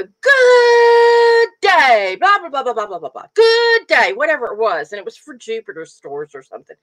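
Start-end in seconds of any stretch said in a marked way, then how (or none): 0.0-4.1 booming radio-ad announcer, higher and louder; hamming up a radio commercial guy's greeting. Retold or imitated. Mother mimicking the radio ad man's booming greeting. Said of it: "this guy would go".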